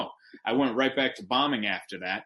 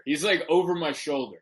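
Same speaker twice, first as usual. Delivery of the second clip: normal talking voice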